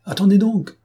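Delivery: high, loud outcry; exclaimed interruption. A narrator's reading. Monsieur Gachard breaking into the hearing, loud exclamation. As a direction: burst in to halt everything. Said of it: "s'écria monsieur Gachard".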